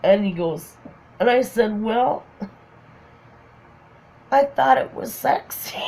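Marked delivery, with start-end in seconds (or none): 1.8-5.8 high-pitched, wounded little excuse; sheepish defence. Retold or imitated. Her explaining the shirt to friend, sheepish. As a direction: justify yourself in a small high voice.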